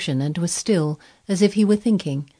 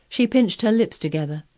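ordinary delivery, nothing marked

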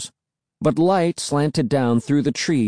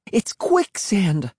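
high, drawn-out cry of horror; horrified cry; 7 semitones higher, slower than usual. A narrator's reading. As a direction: cry out the danger, pitched up and stretched. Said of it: "cry in horror".

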